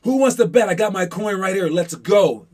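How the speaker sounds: loud, high call for takers; bold challenge. Retold or imitated. Player calling out teammates to bet, loud and high. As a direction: shout it out like the boss. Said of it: "You're gonna be like".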